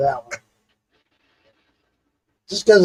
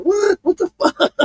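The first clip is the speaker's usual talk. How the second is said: stunned disbelief; 12 semitones higher than usual. Retold or imitated. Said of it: "I'm like".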